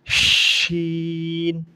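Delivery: "শিন" with a full sh and very long vowel; thick sh. Shown as a demonstration. Teacher models the letter, long thick-sh "শিন". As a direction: stretch "শিন" slowly after a thick sh. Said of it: "শিন একটু মোটা করে".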